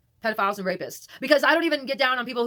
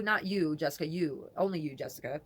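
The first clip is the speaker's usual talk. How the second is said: ordinary talk, nothing marked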